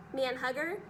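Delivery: plain reading voice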